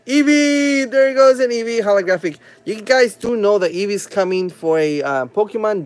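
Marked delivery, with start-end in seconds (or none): 0.1-2.4 high, loud, delighted card reveal; real excitement. Real, not acted. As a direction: call out the lucky pull, pitched up. Pulls a holographic Eevee card.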